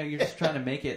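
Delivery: normal talking voice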